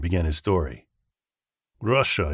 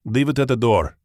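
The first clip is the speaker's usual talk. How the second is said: put-on Russian-accented voice, pitched up; 4 semitones higher, 4 dB louder than usual. A narrator's reading. Man tells courier through door speaker, Russian accent. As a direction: answer with a Russian accent, higher. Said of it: "a Russian accented voice asked".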